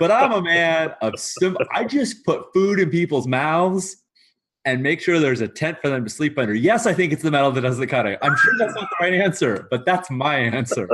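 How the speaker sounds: acted fed-up rant, rising in pitch; exasperated self-defence. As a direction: plead your case with mounting exasperation. Quartermaster defends himself, exasperated.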